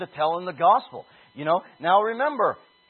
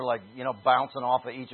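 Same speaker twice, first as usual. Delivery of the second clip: usual speaking voice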